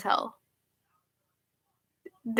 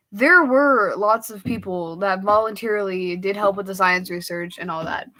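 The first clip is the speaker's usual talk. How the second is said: ordinary talk, nothing marked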